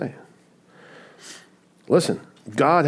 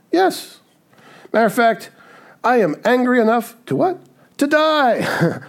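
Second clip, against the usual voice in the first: high, louder mock of a petulant prophet; mocking high Jonah; 11 semitones higher, 4 dB louder than usual. Preacher mocking Jonah's wish to die, high and louder. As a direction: squeal it like a sulky child. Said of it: "that's what Jonah says".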